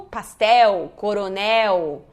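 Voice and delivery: "Pastel" and "coronel" ending in "-éu", Brazilian style; final L as U. Shown as a demonstration. Teacher imitating the Brazilian mistake, L vocalised as U. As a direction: turn the L of "coronel" into a U glide. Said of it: "som de U".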